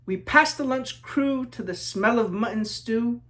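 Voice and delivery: lilting rhyming read-aloud; story narration. A narrator's reading. Author reading to children, storybook narration. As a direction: read it like a picture book to kids.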